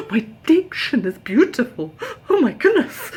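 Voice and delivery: giddy, high flattered squeal; flattered delight. Retold or imitated. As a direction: gush with delight. Flattered inner reaction.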